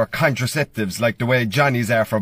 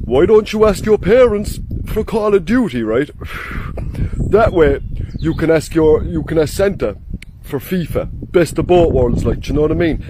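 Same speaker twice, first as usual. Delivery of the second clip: loud, eager know-it-all schoolmate; imitating his friend; 3 dB louder than usual. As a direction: pitch it like a clever scheme, loud and earnest. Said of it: "He goes".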